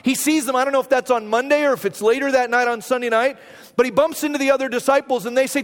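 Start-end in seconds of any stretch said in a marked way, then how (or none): none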